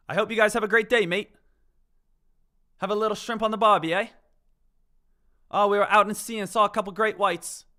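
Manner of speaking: loud, hammed-up Australian accent; Australian impression. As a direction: play a cheery Aussie at full volume. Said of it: "My impressions are pretty spot on".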